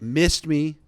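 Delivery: loud, acted gloat; defiant taunt. Host acting Trump's tweet after the shooting, loud taunt. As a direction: crow it loudly at a failed attacker. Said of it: "with, like, the fist up".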